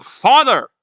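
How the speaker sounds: high, loud yell. Acted. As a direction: yell out for a parent.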